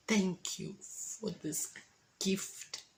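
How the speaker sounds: slow, hushed thanks to God; awed prayer. Real, not acted. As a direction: breathe the words out in wonder.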